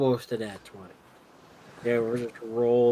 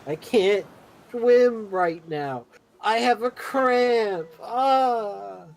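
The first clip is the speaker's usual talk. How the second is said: high, loud, slow mock cries for help; disguised player begging sailors for rescue; fake drowning damsel; 8 semitones higher, 6 dB louder, much slower than usual. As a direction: ham up a swimmer in trouble. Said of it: "I go back to going".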